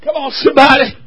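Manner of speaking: ordinary talk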